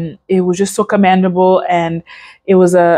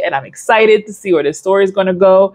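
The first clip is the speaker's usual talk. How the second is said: ordinary talk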